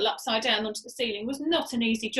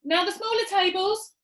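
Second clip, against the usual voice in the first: shouted order; 8 semitones higher, 4 dB louder than usual. A narrator's reading. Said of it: "shouted Muggle Rump".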